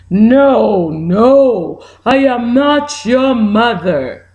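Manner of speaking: a hint of a low, firm hen; lightly voiced hen saying no. Hen answers the bird.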